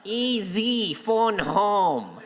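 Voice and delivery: comic extraterrestrial impression; alien voice. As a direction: imitate a little alien calling home. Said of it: "you looked like an ancient alien".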